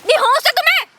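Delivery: high, shocked outburst; indignant, pitch shooting up; outraged rebuke. Acted. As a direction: throw it at him in disbelief. Outraged at his indecent proposal.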